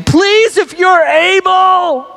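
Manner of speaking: high, drawn-out desperate plea; begging leper. Acted. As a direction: cry out slowly, begging on your knees. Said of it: "he's on his knees in the middle of the town saying".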